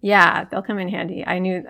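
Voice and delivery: ordinary delivery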